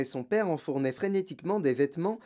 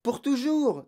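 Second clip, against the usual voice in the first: high-pitched dismayed exclamation; upset girl exclaiming; distressed cry; 11 semitones higher, 4 dB louder, slower than usual. A narrator's reading. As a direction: cry it out as a catastrophe. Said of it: "s'écria Mathilda".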